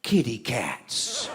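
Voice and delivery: hushed, low, slow "Kitty cats" full of wonder; awed little kitty cats. Retold or imitated. Drunk counselor spotting the skunks, soft childlike wonder. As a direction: breathe it out softly like a child seeing kittens. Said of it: "he said".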